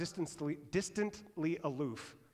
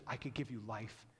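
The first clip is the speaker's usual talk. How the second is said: tender hush; 6 semitones lower, 8 dB quieter than usual. Retold or imitated. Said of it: "Jesus says".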